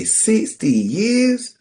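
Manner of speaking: high, slow, stretched-out disbelief; amazed question. Retold or imitated. Host retells asking the old man, drawn-out amazement. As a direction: savor each word in awe.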